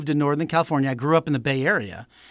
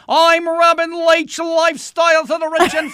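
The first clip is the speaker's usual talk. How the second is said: Robin Leach; 11 semitones higher, 3 dB louder than usual. Acted. Impressionist spoofing a celebrity show host, high yelling impression. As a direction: cry it out high, stretching the words. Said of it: "Robin Leach is somewhere in the middle".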